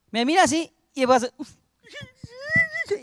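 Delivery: a short mock sob, slow and high; imitated sob. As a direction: act out his crying briefly. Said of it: "empieza a llorar el tipo". (sollozo imitado, breve y agudo)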